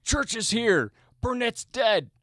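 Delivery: high, loud and stunned; shocked shout. Acted. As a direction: yell it like you can't believe it. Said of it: "we're just like".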